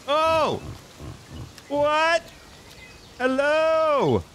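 very high-pitched startled calls; screaming. Real, not acted. Player calling out to whoever is there, screaming. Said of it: "I don't know why I'm screaming".

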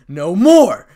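very loud, high yell; shout. A narrator's reading. Issei as the magic circle appears, full shout. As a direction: shout it out with finality. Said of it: "shouted Issei".

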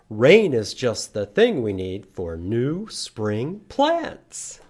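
put-on singsong rhyme for kids; poem recital. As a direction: chant the rhyme brightly. Reading a poem to children.

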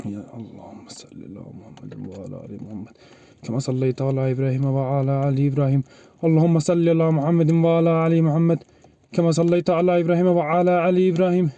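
steady chanted salawat; prayer recitation. Real, not acted. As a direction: recite the prayer in one even tone. Prays salawat under rubble. (dua okuyarak, tekdüze)

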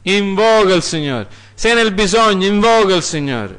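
high, loud urging call; full-force exhortation. Acted. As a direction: urge them to cry out to God. Preacher urges calling on God. (esortazione gridata, alta e forte)

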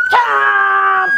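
loud monster bite shout with gasp; big shouted chomp and gasp. Acted.

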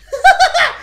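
high, loud gloating cackle; mocking laugh. Real, not acted. Prankster hearing threats to report him, mocking laugh. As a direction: burst out laughing at their outrage. Troll laughs at angry accusations.

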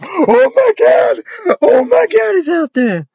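high, loud, crying shriek of terror; mock panic. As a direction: scream like someone spotted a monster. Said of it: "Like the, like".

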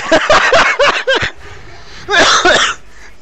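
breathless high wheezing laugh. Real, not acted. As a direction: laugh till you wheeze. Laughs hard at opponent pick.

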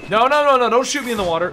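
high, loud rapid pleading; mock panic. Player begging not to be shot in water, loud panic. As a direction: beg them off in playful alarm.

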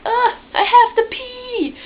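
high, loud, drawn-out whine; urgent kid whine. Acted. Kid in the bath urgently telling mom, high loud whine. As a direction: whine it loudly like a desperate kid. Acting out urgent need as child.

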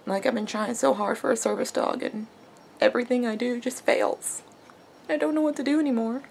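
soft, tearful and broken; real crying, barely holding together. Real, not acted. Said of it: "I'm even crying on camera".

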